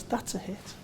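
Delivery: whispered mock wife verdict. Wife plays the tape, whispered verdict. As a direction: breathe it like a secret. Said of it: "The wife plays you and goes".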